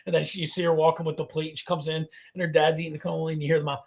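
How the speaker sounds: ordinary delivery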